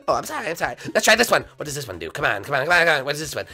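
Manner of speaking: ordinary talk, nothing marked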